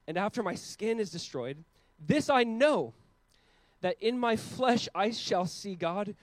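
slow, weighty reading of scripture; solemn scripture reading. Acted. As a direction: read it slowly as holy words. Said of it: "Job says".

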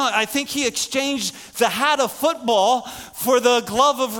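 loud, high, driving preacher voice; full-force preaching. Acted. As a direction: push the message out with force.

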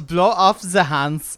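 loud, slow mock German accent. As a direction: drag it out in a hammed-up German accent. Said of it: "Nick has a really good German accent".

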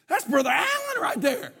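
high-pitched mock astonishment; comic squeal of disbelief; amazed recognition. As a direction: exclaim it as if just spotting an old friend on screen. Recounts recognising him in video.

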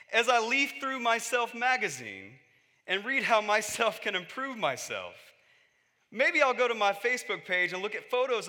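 a preacher imitating Jim Gaffigan's stand-up, mock comic rant; Jim Gaffigan bit. Preacher quoting a comedian's bit to the congregation, mock rant. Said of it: "And here's what he says".

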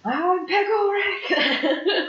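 high squeaky cartoon yell, laughing; Pickle Rick.

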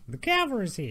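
Tracer. Acted. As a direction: put on an eighteen-hundreds Cockney accent. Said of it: "eighteen hundreds Cockney".

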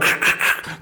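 imitated walkie-talkie crackle; rasping mouth-made static; radio crackle. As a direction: make radio static with the throat. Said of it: "I could just hear the radio".

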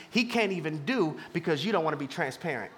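normal talking voice

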